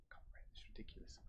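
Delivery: breathy whisper under his breath; whispered aside. Real, not acted. Host whispering an aside about his odd guest, hushed. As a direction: whisper it so the guest can't hear.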